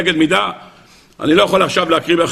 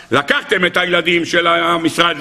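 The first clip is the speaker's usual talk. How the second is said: ordinary talk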